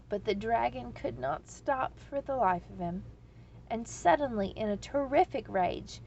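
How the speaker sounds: ordinary delivery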